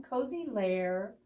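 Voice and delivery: ordinary delivery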